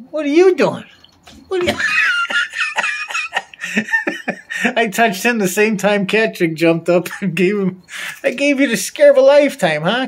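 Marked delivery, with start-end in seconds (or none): 0.1-4.8 startled laughing. Real, not acted. 8.2-10.1 teasing pet talk. Real, not acted.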